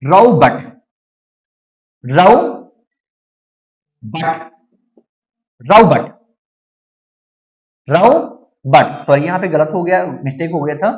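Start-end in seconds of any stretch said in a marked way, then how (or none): 0.0-9.0 रॉबर्ट said as "rau-bat"; low, deliberate रॉबर्ट opening on "au"; British O. Shown as a demonstration. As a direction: stretch a British diphthong in रॉबर्ट. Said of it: "British में ओ को".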